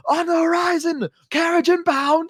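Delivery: a hint of a far-off lookout sounding the alarm; guard calling out. Village guards calling out to the carriage, mock distant shout. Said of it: "guards screaming from the distant village".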